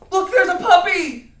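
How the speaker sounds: high, loud cry of alarm; scared. Shown as a demonstration. Teacher demonstrating fear of a puppy, scared. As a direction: shriek it out in panic. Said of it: "we're gonna be scared".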